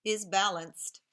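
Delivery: clear, bouncy storybook reading; reading to children. Acted. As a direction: read the story to a class of young kids. Teacher reads picture book aloud.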